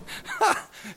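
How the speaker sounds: short high burst of laughter; amused laugh. Real, not acted. Preacher amused by his word "compadres", brief laugh. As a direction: laugh at your own fumble.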